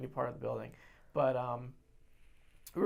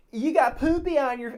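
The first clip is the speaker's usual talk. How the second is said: put-on high, loud, childish taunt; mock drunken screaming; 10 semitones higher, 9 dB louder than usual. Drunk friend heckling people from a window, mock screaming. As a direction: holler it like a silly drunk. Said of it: "he starts screaming at people really stupid things".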